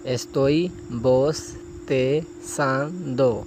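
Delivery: Estoy bostezando drawn out syllable by syllable; very slow model phrase. Shown as a demonstration. Teacher models a Spanish phrase very slowly for students. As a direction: stretch each sound for the learner. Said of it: "اسی طرح آگے ہے".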